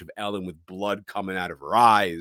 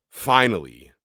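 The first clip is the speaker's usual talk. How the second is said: usual speaking voice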